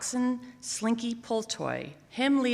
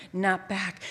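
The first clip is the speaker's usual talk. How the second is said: ordinary delivery, nothing marked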